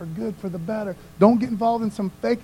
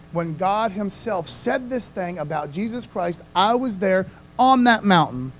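ordinary talk, nothing marked

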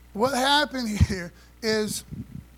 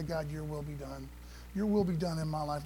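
a hushed, much softer prayer to God; 5 semitones lower, 8 dB quieter than usual. Acted. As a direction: drop to a near-private prayer. Voicing his own prayer.

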